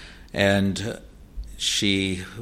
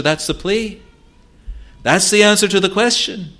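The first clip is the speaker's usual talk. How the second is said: much higher and louder, ringing proclamation; full-force preaching; 8 semitones higher, 6 dB louder than usual. Acted. Preacher answering Satan's accusation, proclaiming at full force. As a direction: preach it out to the back pew. Preaching climax on Christ's plea.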